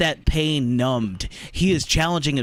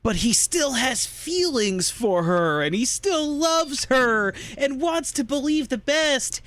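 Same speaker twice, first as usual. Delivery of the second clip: mock-sappy; 10 semitones higher than usual. Host mocking lovesick movie heroes, mock-sappy.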